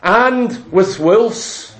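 slow, weighty list. Acted. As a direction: draw each animal out for effect. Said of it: "listing in building slowness".

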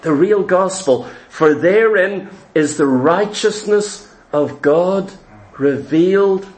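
low, slow and hushed, weighing each phrase; low reverent scripture recitation; solemn scripture reading. Acted. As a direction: read the verse like a sacred revelation.

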